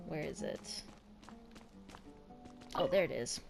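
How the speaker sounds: normal talking voice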